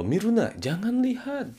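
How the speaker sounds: high, sharp don't-go command; high stern prohibition. Shown as a demonstration. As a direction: forbid it sharply, pitch high. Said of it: "sambil nadanya tinggi". (larangan tegas dengan nada tinggi)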